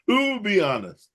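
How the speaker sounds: laughing through the words. Real, not acted. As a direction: laugh as you say it.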